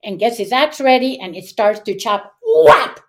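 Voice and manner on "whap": sudden high, loud "whap"; axe-blow imitation. Storyteller voicing the first axe blow, explosive. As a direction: punch out the sound of the chop.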